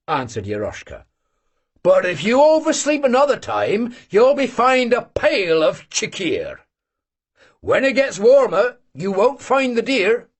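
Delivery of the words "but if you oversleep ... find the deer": higher, louder gruff warning; a jesting threat of a fine; old hunter. Acted.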